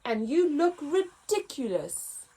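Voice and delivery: mocking elephants. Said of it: "they trumpeted".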